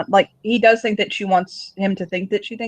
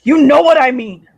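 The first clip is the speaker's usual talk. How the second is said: loud, raised-pitch outburst; heated retort; 5 semitones higher, 9 dB louder than usual. Acted. Confronting her over being taken up with him, heated. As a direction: burst out at her, much louder. Acted confrontation, snapping back.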